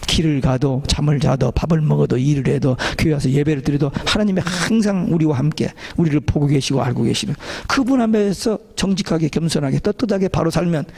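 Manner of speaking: normal talking voice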